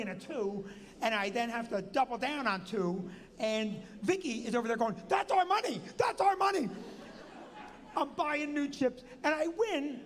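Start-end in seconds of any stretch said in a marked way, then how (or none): 5.1-6.7 high, loud repeated shout; panicked yell. Retold or imitated. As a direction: imitate the wife shrieking over the bet. Wife yells at blackjack table.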